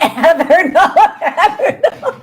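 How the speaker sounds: loud, high laughing outburst; shrieking with real laughter; helpless laughter. Real, not acted. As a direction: crack up mid-sentence, voice shooting up. Bursts out laughing at Heather.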